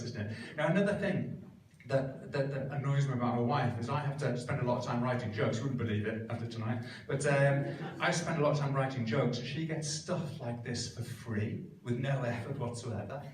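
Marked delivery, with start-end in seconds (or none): none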